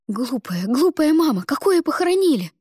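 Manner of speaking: rising to a loud cry; anguished outcry. Acted. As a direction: cry out in disbelief. Ada cries out disbelieving.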